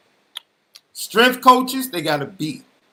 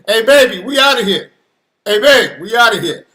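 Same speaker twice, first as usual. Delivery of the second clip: thrilled, punchy shout across the house; excited acted announcement; 4 semitones higher, 7 dB louder than usual. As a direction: call out to your partner, higher and louder. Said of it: "was like, Okay".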